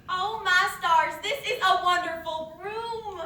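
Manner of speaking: loud, slow, laughing exclamation; gushing delight. Acted. Mother gushes over her daughter's room with loud laughing delight. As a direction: overdo the delight.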